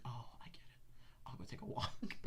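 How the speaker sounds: unvoiced sarcastic whisper; whispered aside. Sarcastic whispered aside, retelling being sent out of the room. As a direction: breathe it out as a sly aside.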